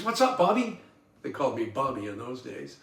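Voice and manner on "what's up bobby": lightly voiced mom, a bit higher; a hint of a mother answering her son; mother's voice. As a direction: mom calling back mildly.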